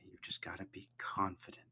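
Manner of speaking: a hushed, private nudge of courage; whispered self-encouragement; whispered pep talk. Acted. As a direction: whisper it to herself. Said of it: "turns away and says".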